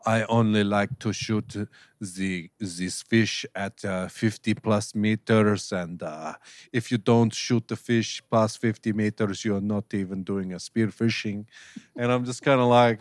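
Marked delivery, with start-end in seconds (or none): 0.1-11.5 slow, put-on French accent. A French diver boasting about fifty-meter depths, mock French accent. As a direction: play a snobbish French spearfisher with a thick accent. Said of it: "It was a good accent".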